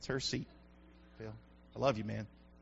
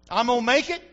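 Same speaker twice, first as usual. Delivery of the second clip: normal talking voice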